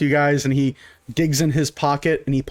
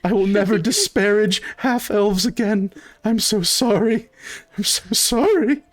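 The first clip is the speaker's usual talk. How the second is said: higher, teary, overwhelmed thanks; tearful grateful waiter; 7 semitones higher than usual. Acted. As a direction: gush through tears at the generous tip. Waiter sobbing thanks, hugging player.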